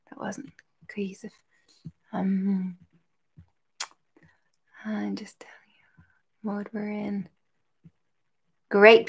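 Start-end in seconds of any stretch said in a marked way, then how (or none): 0.1-7.3 whisper. Real, not acted. Aside over music.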